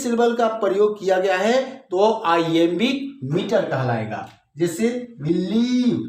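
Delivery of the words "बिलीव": बिलीव with a long, stressed लीव; short बि, then draw out लीव in बिलीव; teacher slowly showing the stress in बिलीव; stress on second syllable. Shown as a demonstration. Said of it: "stress हमारा यहां आ रहा है".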